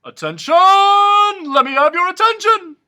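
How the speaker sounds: huge high royal shout; booming king shout; commanding king. A narrator's reading. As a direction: pound the table and demand attention. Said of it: "insisted the king".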